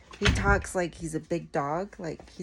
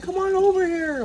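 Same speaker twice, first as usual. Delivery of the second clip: much higher, louder cartoon dog voice; dog voicing; 13 semitones higher, 9 dB louder than usual. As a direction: voice the dog as a tough little guy. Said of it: "He's like".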